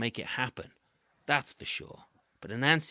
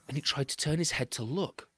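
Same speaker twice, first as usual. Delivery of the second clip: ordinary delivery, nothing marked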